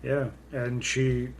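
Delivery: usual speaking voice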